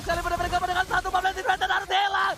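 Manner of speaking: high, loud play-by-play; esports hype call. Real, not acted. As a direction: call the fight with rising hype.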